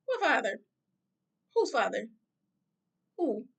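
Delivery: retold disbelief, sharp falling questions; incredulous retort. Teen answering a stranger who claims fatherhood, incredulous.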